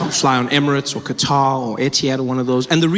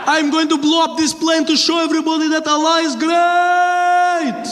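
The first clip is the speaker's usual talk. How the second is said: put-on slow, high accented proclamation, last word stretched out; mock zealous terrorist; 11 semitones higher than usual. Would-be bomber announcing his plan, zealous accented mock shout. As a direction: declaim it in a put-on Middle Eastern accent.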